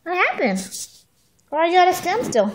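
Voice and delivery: high, quick cooing questions. Real, not acted.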